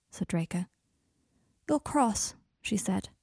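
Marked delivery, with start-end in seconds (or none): 1.7-2.3 talking through a mouthful of pasta; words round a mouthful of food; mouth full. Acted. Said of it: "her mouth full of pasta".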